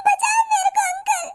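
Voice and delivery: high, slow, pleading cry for help; frightened girl begging uncle for help; tearful plea. Acted. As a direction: beg the stranger to save you, near tears.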